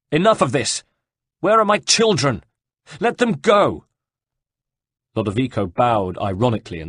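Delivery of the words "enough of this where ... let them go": high, loud demands; angry cry. A narrator's reading. Caterina demands her children from the Orsi brothers, crying out angrily. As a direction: demand it as a desperate mother, voice raised. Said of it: "cried Caterina".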